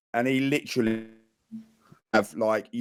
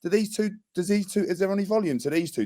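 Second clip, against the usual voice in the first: higher, mock-baffled teasing of the young lads; 7 semitones higher than usual. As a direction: tease them in a high, puzzled mock voice. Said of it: "keep sort of almost going like".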